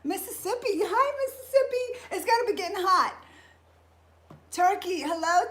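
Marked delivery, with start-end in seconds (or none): none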